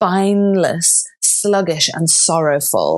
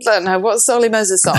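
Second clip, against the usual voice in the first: ordinary delivery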